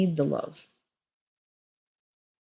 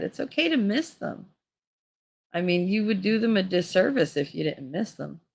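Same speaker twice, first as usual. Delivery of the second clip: usual speaking voice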